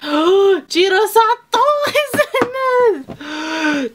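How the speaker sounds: gasps, then a high, loud, slow cry of delight; gasping surprise. Real, not acted. Fan spotting her favourite actor in the cast, squealing with delight. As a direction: squeal it out, thrilled and disbelieving.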